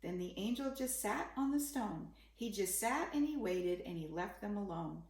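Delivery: plain reading voice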